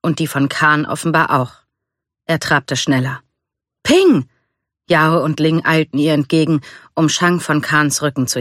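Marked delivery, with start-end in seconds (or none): 3.8-4.3 high, loud hail; calling out. A narrator's reading. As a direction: shout the name across the camp. Friends hail her arrival at camp.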